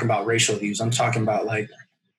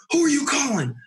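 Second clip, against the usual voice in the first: much higher, imitating a cop flipping out; retold demand, pitched way up; mock cop outburst; 11 semitones higher than usual. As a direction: snap it like the angry officer. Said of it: "flips out".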